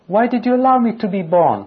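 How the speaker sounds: high, loud and quick, a cry of anguish; pleading up at heaven, voice climbing; anguished plea. Retold or imitated. Preacher voicing Job's plea to God, anguished and high.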